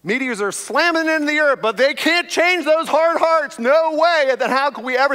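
fiery preaching climax; preaching at full force. Real, not acted. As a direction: thunder it out to the congregation.

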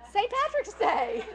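high, slow, drawn-out reply; astonished they even have to ask; incredulous retort. Retold or imitated. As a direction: stretch it out high as if it were obvious. Retelling her indignant college reply.

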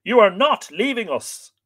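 high, loud protest; exclaiming. A narrator's reading. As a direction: exclaim it in alarmed objection. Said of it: "exclaimed Gall MacMorna".

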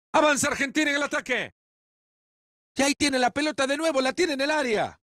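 fast, high excited commentary; fast play-by-play. Acted. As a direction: race through the attack call. Rapid attacking play-by-play.

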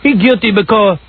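high, slow mock Puerto Rican accent. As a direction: imitate a Puerto Rican guy arguing, drawn out. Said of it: "the Puerto Rican guy comes up and goes".

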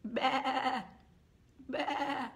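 wobbly bleats, one at a time; sheep bleating. Acted. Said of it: "or if they go".

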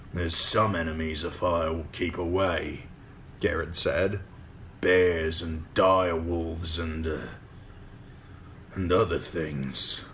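put-on slow, grudging old soldier; weary veteran pushing back; gruff old ranger. Garrett answers the lordling.

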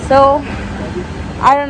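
muffled behind surgical mask; through a face mask. Real, not acted. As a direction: mouth covered by cloth. Seen 0: Surgical mask covers speaker's mouth and nose throughout; pulled down in refs.

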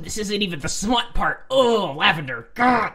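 high, loud groaning complaint; flustered exasperation. Real, not acted. Reader embarrassed by a suggestive story, flustered exasperation. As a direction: protest loudly, embarrassed by the reading.

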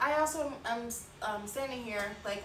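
ordinary talk, nothing marked

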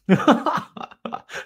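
high breathy burst of real laughter. Real, not acted. Teacher cracking up at the student's essay, real laughter. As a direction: let a real laugh escape.

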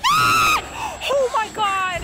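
high, loud yell of fright; real frightened outburst; terrified shout. Real, not acted. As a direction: scream as the enemy lunges. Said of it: "That was loud".

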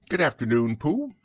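high, lilting motherly greeting; Kanga. Acted. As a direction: answer politely, swooping up high on the name. Kanga answers Pooh in character.

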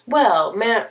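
ordinary talk, nothing marked

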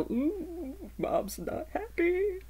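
high, soft, slow singsong; hushed, high-pitched dog voice, words drawn out; dogs' imagined voice. Acted. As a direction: voice the dogs tiptoeing round Mom. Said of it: "they usually go".

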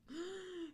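sharp high gasp; startled gasp. Acted. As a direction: gasp in fright.

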